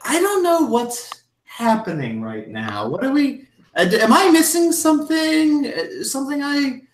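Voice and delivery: put-on high, flustered older lady voice; a confused hostess losing the thread. Missus Garrison bewildered by the table's silence, high and flustered.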